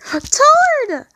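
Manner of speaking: a desperate sobbing call to his friend; sobbing cry. A narrator's reading. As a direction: cry the name out through tears. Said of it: "Tom sobbed".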